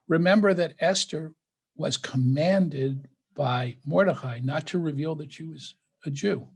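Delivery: ordinary talk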